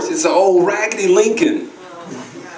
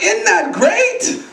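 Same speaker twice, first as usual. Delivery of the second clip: put-on high, drawn-out child's enthusiasm; delighted daughter; 8 semitones higher, much slower than usual. Daughter to dad about the doll, delighted gushing. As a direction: stretch it out high, showing off the doll. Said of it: "my daughter was like".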